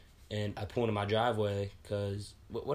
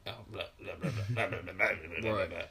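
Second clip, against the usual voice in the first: garbled, dismissive imitation of babble; mock mumbling; 2 semitones lower than usual. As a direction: mimic a coward mumbling nonsense. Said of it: "just be like".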